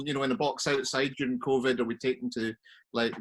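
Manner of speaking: ordinary talk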